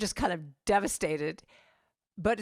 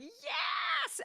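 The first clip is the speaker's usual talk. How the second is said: very high, whooping yes; crowd's cheer; 4 dB quieter than usual. Retold or imitated. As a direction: cheer like an audience on its feet. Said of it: "Everyone's going".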